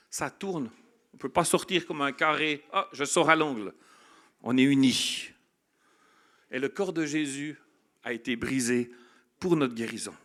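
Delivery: loud, rising preacher's cadence; full-force preaching. Acted. Pastor rallying the congregation around the circle, loud fervent preaching. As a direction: preach it out to the whole room.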